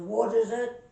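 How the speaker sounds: very loud Irish drinker; Irish accent. Acted. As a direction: bellow it like an Irishman in a pub. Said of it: "Paddy's knocking them back pretty good".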